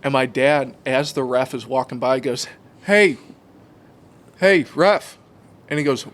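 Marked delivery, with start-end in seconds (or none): 2.8-5.2 raised, loud sideline holler; calling out. Retold or imitated. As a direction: get the ref's attention as a dad. Dad calling out to referee.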